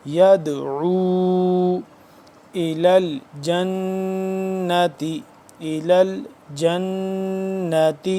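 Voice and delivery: slow melodic Quranic chant; Quran recitation. Shown as a demonstration. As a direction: recite it like tilawat with long vowels. Recites verse. (قرآن کی تلاوت کا انداز)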